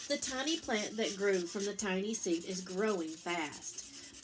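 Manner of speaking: ordinary delivery, nothing marked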